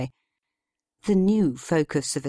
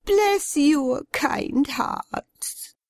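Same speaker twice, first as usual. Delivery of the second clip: high, slow, trembling thanks; quivering lip; 12 semitones higher, much slower than usual. A narrator's reading. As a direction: tremble on the words. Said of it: "she said with a quivering lip".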